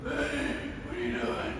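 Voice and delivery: faint, airy voice of a sick dad; frail dad calling his son; a hint of a weak father. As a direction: play the ailing father softly. Voicing dying father on phone.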